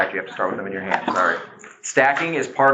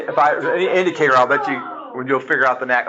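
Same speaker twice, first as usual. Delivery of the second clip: ordinary delivery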